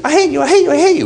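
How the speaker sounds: high, hammed-up "I hate you"; mock woman's voice. Imitating a woman telling her man she hates him, high and mock. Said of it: "she's saying".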